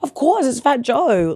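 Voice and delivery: high-pitched overeager reassurance, slowing at the end; flustered, scared. Retold or imitated. Nervous student reassuring the rapper she knows him, flustered. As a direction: re-enact panicked recognition, voice way up. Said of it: "was scared to say that".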